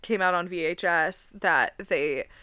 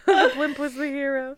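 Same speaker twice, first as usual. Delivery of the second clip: laughing; 5 semitones higher, 3 dB louder than usual. Real, not acted. Says it laughing.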